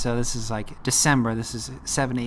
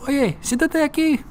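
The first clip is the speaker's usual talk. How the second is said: high, loud call across the street; he calls to a passing girl, a high loud yell; yelled in Spanish; 4 dB louder than usual. Retold or imitated. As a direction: call out to her in Spanish, much higher. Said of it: "I yelled at her in Spanish".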